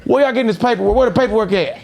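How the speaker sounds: high, loud accusing rant through a laugh; ripping into them. Retold or imitated. Tearing into Johnny about the diamonds, loud and laughing. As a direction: hammer them with questions, loud.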